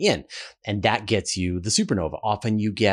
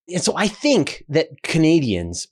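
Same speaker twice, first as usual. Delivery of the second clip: ordinary talk, nothing marked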